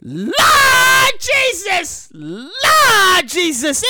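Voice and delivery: slow, high, drawn-out mock lament; mock wail. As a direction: wail to the Lord, hammed-up. Mock wailing prayer.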